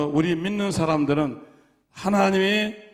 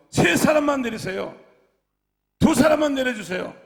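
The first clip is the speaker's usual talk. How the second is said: captain pleading; 7 semitones higher, 5 dB louder than usual. Retold or imitated. Captain pleads again, louder.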